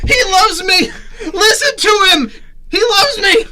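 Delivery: high, loud, laughing with joy; overjoyed laughing shout. Acted. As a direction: cry it out laughing with delight. Dad thrilled, laughing.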